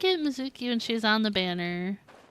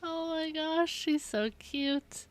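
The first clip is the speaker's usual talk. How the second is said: adoring coo; 6 semitones higher, 3 dB quieter than usual. Real, not acted. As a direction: gush softly over something adorable. Melting over adorable game character.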